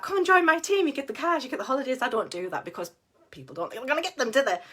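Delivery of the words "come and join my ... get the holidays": a higher, hammed-up sales pitch; mock salesy recruiter. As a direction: dangle the cars and holidays like a cheesy seller. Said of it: "I don't recruit that".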